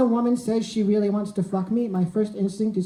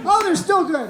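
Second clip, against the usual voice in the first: pleased surprise; 6 semitones higher, 4 dB louder than usual. Retold or imitated. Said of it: "It's like".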